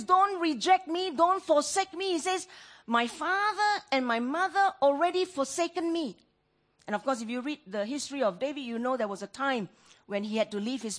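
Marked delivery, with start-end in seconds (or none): 0.0-6.2 high, loud begging prayer; loud desperate plea. Acted. Preacher voicing the psalmist afraid of being forsaken, loud desperate plea. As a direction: plead as if about to be abandoned. Psalmist pleading against rejection.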